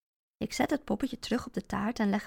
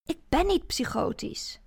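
angrily; 6 semitones higher, 3 dB louder than usual. Acted. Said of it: "zeg ik kwaad".

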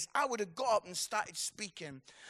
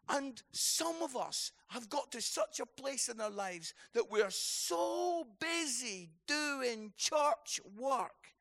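normal talking voice

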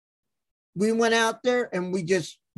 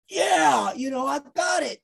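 retold brag, pitched up and a touch shouted; cocky boast; 6 semitones higher than usual. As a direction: brag it like you just nailed the spot. Retelling cocky reply after big spot.

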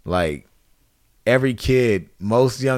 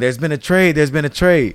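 raised, louder call of there's been a trade; excited announcement; 6 semitones higher, 4 dB louder than usual. Retold or imitated. GM yelling news of a trade across the room, excited. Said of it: "I hear him in the background".

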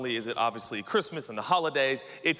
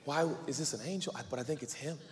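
awed murmur; 10 dB quieter, faster than usual. Retold or imitated. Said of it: "and I'm like".

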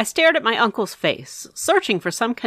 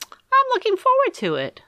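lightly voiced eager uncle, higher; a hint of a playful uncle, pitched up; Uncle Harvey. A narrator's reading. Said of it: "said Uncle Harvey".

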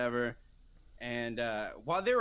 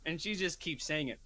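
ordinary talk, nothing marked

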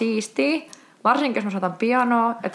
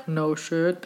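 low, soft deadpan aside; dry sarcasm; 5 semitones lower, 5 dB quieter than usual. Real, not acted. Reader commenting on an obvious list, deadpan. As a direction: drop it under the reading.

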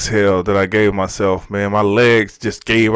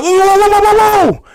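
very high, loud burst of rapid whoas; shocked whoas; 7 dB louder than usual. Retold or imitated. Reliving being vacuumed out of meditation, shocked yelping. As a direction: yelp a run of whoas up high.

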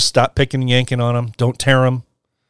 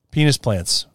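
ordinary talk

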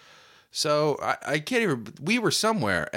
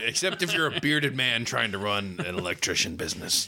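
Larry Hogan impression; 6 semitones lower than usual. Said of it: "Larry Hogan here".

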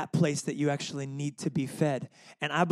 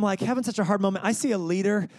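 usual speaking voice

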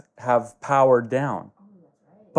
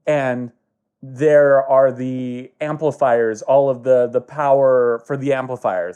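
ordinary delivery

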